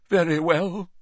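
high, offended and hurt; deeply wounded. Acted. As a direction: say it with wounded dignity. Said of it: "in a deeply wounded voice".

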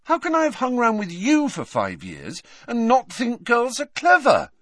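high-pitched indignant protest; hurt, heated comeback; stung. A narrator's reading. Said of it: "stung by this".

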